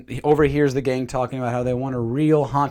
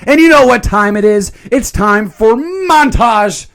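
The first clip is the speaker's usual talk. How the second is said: hyped announcement; 6 semitones higher, 5 dB louder, slower than usual. Acted. Hyping the montage like announcer.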